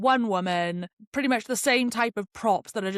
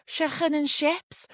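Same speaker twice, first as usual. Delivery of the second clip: high, slow put-on Scouse; hammed-up Scouse catchphrase, pitched high; Scouse accent; 7 semitones higher, slower than usual. Shown as a demonstration. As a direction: exaggerate a Liverpool accent, drawn out. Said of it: "I usually gotta say something like".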